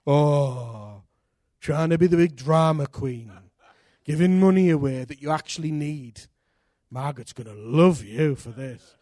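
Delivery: a lightly voiced sneering devil; mocking devil. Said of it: "he was laughing at me".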